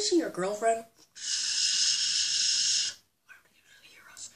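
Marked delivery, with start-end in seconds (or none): 3.3-4.3 hushed unvoiced whisper. Link whispers so Zelda won't hear. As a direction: keep it secret from her.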